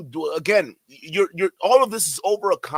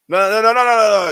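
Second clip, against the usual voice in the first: loud, higher repeated no; shouting no; 5 semitones higher, 11 dB louder, faster than usual. Real, not acted. Streamer shouting down the guest, loud no. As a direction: yell no over and over at the clip.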